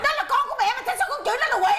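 high, rapid outraged protest; mother's shrieky, hurried rebuke; indignant scolding. Acted. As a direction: scold fast at a high pitch. Mother scolds over insult to son.

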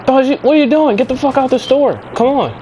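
high-pitched, alarmed ordering; urgent scolding. Acted. Driver orders Baljeet out of robbed store, urgent. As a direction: order him out in exasperated panic.

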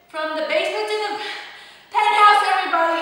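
rising to a loud, high peak on penthouse; rally the whole class with a big shout; building excited cheer; hyped-up call-out. Real, not acted. Hypes up the class mid-exercise.

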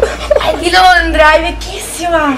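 drawn-out embarrassed cry; slow dismayed protest. Real, not acted. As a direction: stretch it out in mock horror.

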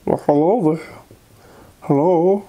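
put-on a playful old-fashioned hello; old-timey voice. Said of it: "like the way they talk back then".

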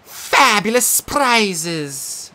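high and slow, savouring each word; game show host. Acted. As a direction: tease the prizes. Sphinx as quiz host.

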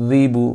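যিবু dragged out, "dheeee-buuu"; wrongly stretched. Shown as a demonstration. Teacher showing what may not be lengthened, stretched-out যিবু. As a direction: lengthen both vowels of যিবু. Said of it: "এগুলো টানা যাবে না".

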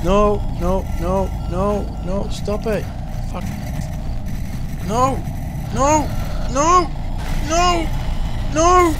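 high frantic breathless noes; breathless fright. Real, not acted. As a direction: pant out noes in panic. Chased while running.

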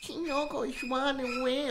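very slow, eerie whisper of a horror ghost; creepy ghost girl. Retold or imitated. Girlfriend in the dark kitchen at night, eerie slow ghost voice. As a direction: drag it out like a possessed girl at night.

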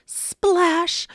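loud, high burst on the word; exclaimed splash. A narrator's reading. Mister Carp jumps into the pool, loud exclamation.

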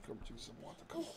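whispered offer of water; hushed coaxing. Real, not acted.

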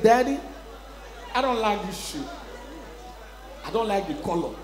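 lightly voiced small boy, soft; a subdued child complaining to daddy. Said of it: "One small boy, nine years old".

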